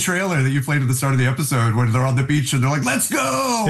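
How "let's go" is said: high, drawn-out mock battle cry; imitated rallying shout. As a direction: belt it out like a movie-trailer rallying cry. Quoting trailer's rallying cry on beach.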